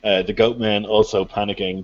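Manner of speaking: usual speaking voice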